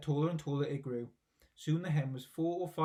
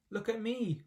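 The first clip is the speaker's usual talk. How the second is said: shout; 7 semitones higher than usual. A narrator's reading. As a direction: demand attention from the roof. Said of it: "Grandma shouted from the rooftop".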